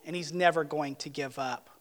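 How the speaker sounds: ordinary talk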